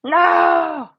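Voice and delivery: loud, high no; dismayed shout. Real, not acted. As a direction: yelp of alarm at a mistake.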